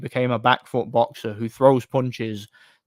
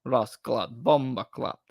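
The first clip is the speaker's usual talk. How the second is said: put-on a weak Jamaican accent, softer; 4 dB quieter, slower than usual. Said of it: "I love your popular Jamaican accent".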